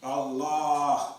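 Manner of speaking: "Allah" with the doubled "l" stressed and the last vowel held; emphasis on the lam. Shown as a demonstration. Teacher showing where the emphasis falls, stressed, drawn-out "llah" in "Allah". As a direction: lean on the "ll" in "Allah", drawing it out. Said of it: "See, the emphasis is on the word".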